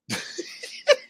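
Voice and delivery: breathy high-pitched burst of laughter. Real, not acted. Reacting to a viewer's message, real amused laugh.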